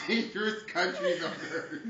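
reading with a stunned laugh; laughing while reading. Real, not acted. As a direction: laugh through the grim line. Laughs mid-sentence reading grim fact.

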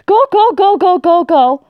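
high, loud rapid-fire urging; urgent panic. Real, not acted. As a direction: urge them on in a panic. Urging game character to flee.

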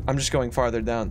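ordinary talk, nothing marked

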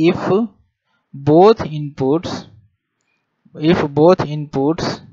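each word drawn out slowly for note-taking; slow classroom dictation with pauses. Acted. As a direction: dictate it word by word so students can write.

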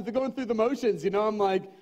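usual speaking voice

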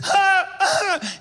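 very high, loud gasping ah; woman collapsing into the aisle; panicked cries. Retold or imitated. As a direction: cry out in distress. Woman in distress.

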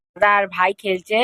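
usual speaking voice

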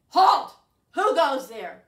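a sudden troll roar from under the bridge; troll shout. Acted.

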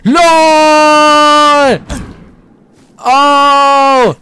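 high, loud panicked shouts; panicked yelling. Real, not acted. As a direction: yell it in sudden panic. Panicked shouts at game danger.

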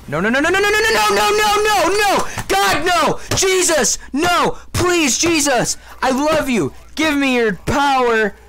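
high, frantic rising cries; panicked gamer praying aloud; desperate pleading. Real, not acted. Desperate begging while about to die.

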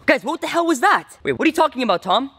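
rattled and incredulous; freaked out. Real, not acted. Vlogger freaked out by the app's domination talk, alarmed high voice. App talks about domination.

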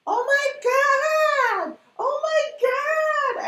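very high, drawn-out mock wail; imitated assistant's yell. Assistant exasperated at how her boss works, hammed-up yelling. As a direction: wail it loud and slow, hammed-up. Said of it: "yelling at me every day".

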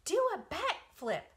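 high, loud call; loud high command; crying out. Acted. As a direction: spider crying to the pig. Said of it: "cried Charlotte".

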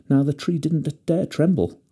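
ordinary delivery

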